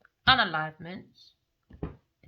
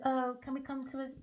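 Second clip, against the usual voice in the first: lightly put-on meek plea, higher then softer; pleading man; 5 semitones higher, 6 dB quieter than usual. Reader voicing Leo begging at work, lightly voiced and meek. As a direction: ask for peace in a meek put-on voice. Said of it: "He said".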